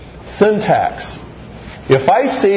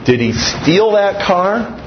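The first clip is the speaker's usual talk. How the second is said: slow, higher, that punched; stress on that; 5 semitones higher, slower than usual. Shown as a demonstration. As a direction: lean on that, this car of all cars. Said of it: "five different things".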